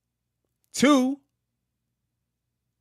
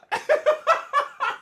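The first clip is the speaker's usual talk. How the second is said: high burst of laughter; real laughter. Real, not acted. Streamer reading [name] lawsuit response, cracking up laughing. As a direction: crack up helplessly mid-reading.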